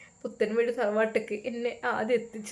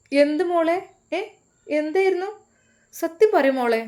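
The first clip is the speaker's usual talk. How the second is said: shocked mother; 7 semitones higher, 5 dB louder than usual. Acted. Mother shocked by second wife.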